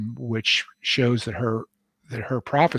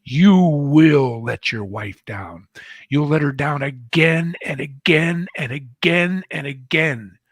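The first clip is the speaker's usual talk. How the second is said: slow, loud shouted warning, pitched up; retold scream; 3 semitones higher, 7 dB louder, slower than usual. Mild-mannered man screaming at the young author, loud slow shout. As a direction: hammer each again like a curse. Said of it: "And he screamed at me".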